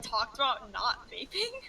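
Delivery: ordinary talk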